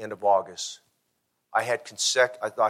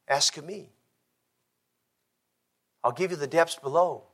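altar call. Acted. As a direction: invite them gently to the altar.

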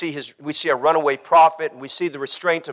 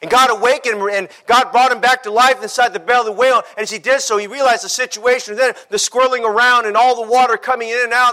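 driving sermon delivery; impassioned preaching; 6 semitones higher, 5 dB louder than usual. Acted. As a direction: preach it with rising urgency. Preaches at full force.

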